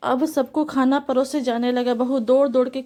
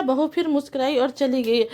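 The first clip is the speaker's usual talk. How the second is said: ordinary delivery, nothing marked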